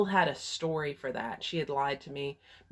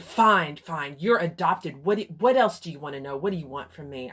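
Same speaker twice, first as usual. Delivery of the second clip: angry outburst; 7 dB louder than usual. Retold or imitated. Mother angrily admitting adoption.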